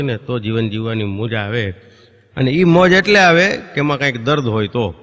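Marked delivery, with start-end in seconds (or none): none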